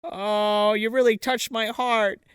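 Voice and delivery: high, drawn-out put-on sentimental voice; aunt turned tearful. Nurse aunt is touched then defensive, high mock-tearful voice. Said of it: "Ruth has her schizophrenic moment".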